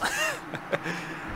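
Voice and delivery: breathy laugh at his own death; rueful laugh. Real, not acted. Player laughing at his own death, rueful breathy laugh. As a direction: laugh it off after dying.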